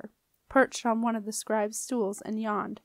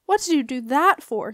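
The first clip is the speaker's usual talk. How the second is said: loud, indignant question; raised-voice outrage; furious demand; 3 semitones higher, 7 dB louder than usual. A narrator's reading. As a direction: demand an explanation angrily. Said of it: "Nico was furious".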